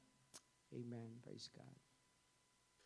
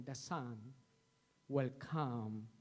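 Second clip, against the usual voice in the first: ordinary talk, nothing marked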